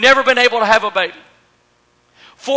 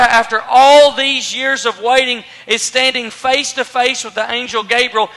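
usual speaking voice

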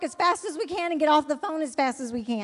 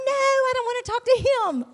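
high, louder frantic no; panicked refusal; 8 semitones higher, 5 dB louder than usual. Retold or imitated. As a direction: refuse the phone in comic alarm. Panicked refusal to take phone.

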